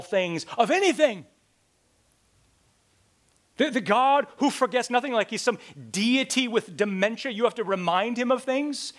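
loud, rising, incredulous preaching; scornful preacher's shout; mock outrage. Real, not acted. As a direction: hammer the absurdity home. Mocking an irreverent idea.

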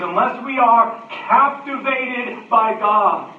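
loud, high and slow; impassioned preaching. Real, not acted. Preacher urges people to be captivated by God, impassioned preaching. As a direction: ring it out slowly at full force. Preacher climaxes on his appeal.

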